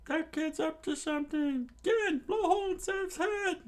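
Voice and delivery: very high squeaky voice; pirates screaming. Acted. Pirates yelling at Gin to shoot, high squeaky voice. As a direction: squeal orders like cartoon pirates. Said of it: "they screamed out".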